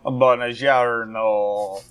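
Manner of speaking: long, drawn-out Italian hello; hammed-up Italian, stretched out; mock Italian greeting. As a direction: put on an Italian accent, very slowly. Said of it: "Very international".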